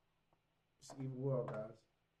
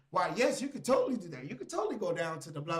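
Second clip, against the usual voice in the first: higher, louder gushing helper voice; mock eager approval; 4 semitones higher, 6 dB louder than usual. AI cheering on the user's idea, mock eager. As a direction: oversell the encouragement, pitched up.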